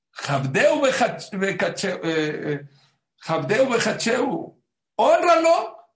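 loud, high declamation of the verse; loud declaiming. Acted. Preacher calls out the verse, loud and high. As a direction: cry out the verse, peaking on hónralo.

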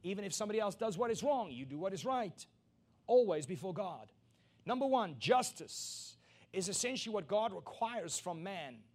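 loud, high pulpit climax; preaching at full force. Acted. Preacher pressing his point home, loud and high.

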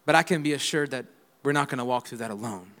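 hushed assured testimony; quiet conviction. Real, not acted. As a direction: draw them in close and steady. Quiet reflective sermon turn.

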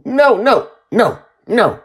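put-on high, loud child's cries; panicked refusal. A narrator's reading. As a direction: wail each no in protest. Child keeps refusing loudly.

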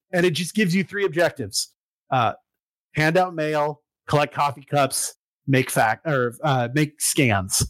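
ordinary talk

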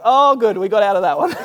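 loud, high burst of comic relief; relieved. Retold or imitated. Embarrassed tourist to himself, relieved at getting out of it. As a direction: let the relief burst out, easing toward a laugh.

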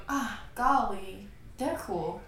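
soft, slow, self-mocking murmur; mock shy mumble. Imitating her shy self to co-hosts, soft and slow. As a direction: play your timid self in your room, quietly. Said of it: "looks like this".

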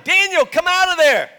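high, loud call into a pit; king's commanding yell. Acted. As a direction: shout down to someone below. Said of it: "So he yells down".

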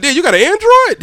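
high, loud mocking friend; mock disgusted high voice. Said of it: "They be like".

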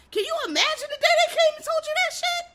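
very high, loud incredulous cry; shrieked amazement at the top of the range; outraged disbelief. Retold or imitated. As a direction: let disbelief boil over into a squeal.

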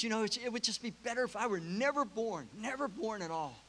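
high, despairing anger; George, soaked and bitter, wishing he'd never been born. Retold or imitated. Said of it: "in anger, he says".

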